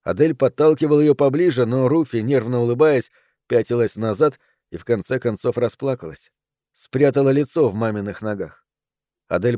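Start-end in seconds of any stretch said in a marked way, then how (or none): none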